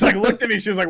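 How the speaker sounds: normal talking voice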